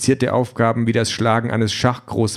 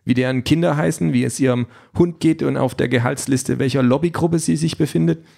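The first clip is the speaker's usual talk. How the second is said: higher voice for the questioning woman; sceptical Aisha; 3 semitones higher than usual. Acted. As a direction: pitch it up, pressing with questions. Voices Aisha questioning the candidate's setup. (skeptische Frau, höhere Stimme)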